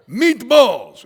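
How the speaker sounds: high, booming mock shout; loud insistent repeat. Showing shouting won't help waiters, loud shout. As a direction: yell it as if volume translates. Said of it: "it doesn't matter if you go".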